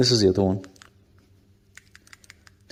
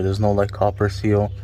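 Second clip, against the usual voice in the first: normal talking voice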